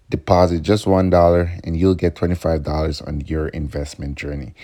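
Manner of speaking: usual speaking voice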